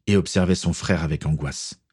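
ordinary delivery, nothing marked

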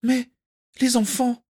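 very high, anxious mother; worried. A narrator's reading. A mother asks after her children, high and worried. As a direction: fret aloud in a high voice. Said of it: "s'inquiéta la mère".

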